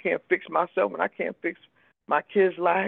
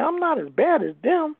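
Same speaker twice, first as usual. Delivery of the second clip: higher, self-assured denial; dismissive denial; 10 semitones higher than usual. Retold or imitated. Comparing herself to crackheads, higher dismissive voice. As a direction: brush it off as if above them. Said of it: "So I said".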